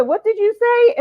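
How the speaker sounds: high, loud startled demand; incredulous retold question, pitched high. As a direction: act out being taken aback, voice jumping up. Retold startled question on massage table.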